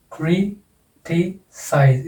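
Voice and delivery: "Criticise" very slow, in three pieces; syllable by syllable. Shown as a demonstration. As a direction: split "criticise" into its syllables with pauses. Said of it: "इसमें भी तीन syllable है".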